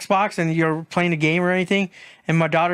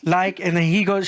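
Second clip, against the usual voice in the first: normal talking voice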